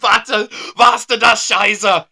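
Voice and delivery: slow, loud, high fake German; mock German, slow and loud. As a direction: hammer out fake German slowly. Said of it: "mock German phrase".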